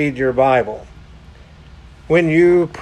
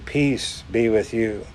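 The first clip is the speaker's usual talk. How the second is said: soft and gentle, much quieter; tender blessing; 5 semitones lower, 7 dB quieter than usual. Acted. Jesus blessing frightened disciples, softly. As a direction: lay peace over them like a hush.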